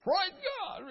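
high, excited shout of praise; new believer happily shouting praise God. Retold or imitated. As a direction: burst out like a fresh convert. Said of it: "start going off".

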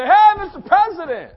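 loud, high shout across a crowd; excited bystander's yell; calling out. Acted. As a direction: holler to get a leader's attention.

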